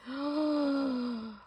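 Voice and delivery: long, high gasp of wonder; real awed intake of breath; amazed gasp. Real, not acted. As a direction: gasp in delighted surprise. Opening a gift, stunned by it.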